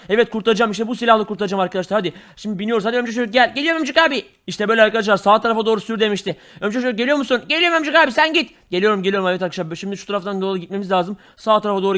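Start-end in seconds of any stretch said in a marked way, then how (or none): 3.5-4.2 put-on high, eager little kid voice; high kid sidekick begging to be waited for. 7.5-8.5 put-on high, eager little kid voice; high kid sidekick begging to be waited for.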